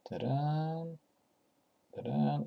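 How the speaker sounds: stretched, slow fanfare ta-da; playful ta-da. Pretend. Tutor revealing the compiled index with a playful ta-da. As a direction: present the result like a magic trick.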